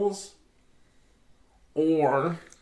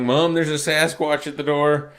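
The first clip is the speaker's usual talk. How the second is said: put-on raised, louder kid yelling for mom; mock call to mom; 3 semitones higher, 7 dB louder than usual.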